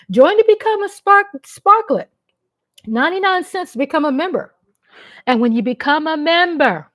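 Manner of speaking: high, loud and eager, selling the membership; bright promo call to the audience; excited sales pitch. Real, not acted. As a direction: pump up the viewers to join. Channel membership pitch to viewers.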